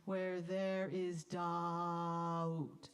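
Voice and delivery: Where there is doubt slow, doubt held on ah; late diphthong. Shown as a demonstration. Conductor modelling the vowel of doubt, slow. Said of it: "the diphthongs come very late".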